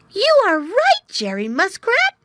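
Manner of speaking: high, loud eager cry; young otter crying out in surprise; excited cry. Acted. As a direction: burst out with the discovery. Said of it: "he cried".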